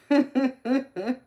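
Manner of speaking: loud, high burst of laughter; real laugh. Real, not acted. Streamer amused by the trolls, loud high laughing.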